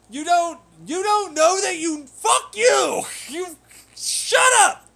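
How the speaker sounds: high, very loud screaming at critics; hammed-up raging retort; mock furious yelling. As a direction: shout them down, slow and enraged. Yelling back at imagined critics.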